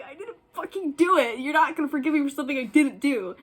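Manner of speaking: high, loud and fast, acted-out outrage; angry retort. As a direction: fire back at a false accusation. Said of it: "I was so mad at her".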